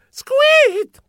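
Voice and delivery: very high, loud "squeet"; high mouse squeak. Acted. Mouse signs off the adventure, high squeak. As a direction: give a gleeful mouse squeak.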